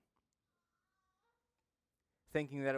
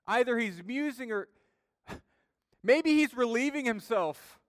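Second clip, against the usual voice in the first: higher, louder sarcastic ribbing; mock taunt; 7 semitones higher, 6 dB louder than usual. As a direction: tease them about their sleeping god. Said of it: "Elijah mocked them".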